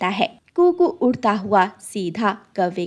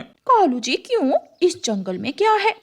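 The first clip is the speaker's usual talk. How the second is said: higher, surprised she-crow; puzzled crow wife; 6 semitones higher than usual. Acted. Crow wife asks husband why they must flee, surprised. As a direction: ask why, startled and high.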